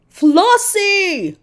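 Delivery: high calling out her name. A narrator's reading. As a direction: call to her through the door. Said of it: "Doctor Dribble called".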